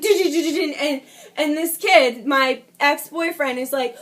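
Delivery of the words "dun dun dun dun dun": rapid thudding syllables for feet on stairs; imitated running footsteps. Imitating the boys racing up the staircase, quick thudding noises. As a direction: rattle it off like pounding footsteps. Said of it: "I hear them, like".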